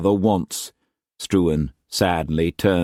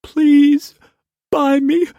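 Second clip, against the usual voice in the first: high, much louder broken plea; brokenly; 7 dB louder, slower than usual. A narrator's reading. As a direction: let the voice break on the plea. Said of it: "she said brokenly".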